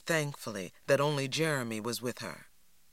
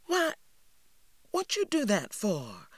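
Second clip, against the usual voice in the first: startled; 7 semitones higher than usual. A narrator's reading. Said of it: "more startled than angry".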